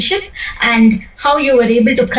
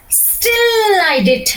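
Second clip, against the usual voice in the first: "Still" pitched high and stretched slowly; rising on still; 8 semitones higher, much slower than usual. Shown as a demonstration. Coach shows a trainee a slow high stress on "Still". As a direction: hold "Still" up high, then let it fall. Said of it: "So you are raising here".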